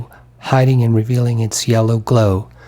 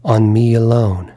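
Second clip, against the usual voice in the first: ordinary delivery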